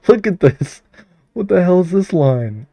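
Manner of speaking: bursting into laughter; laughing. Real, not acted. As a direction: laugh out loud at the screen. Streamer laughing at odd code.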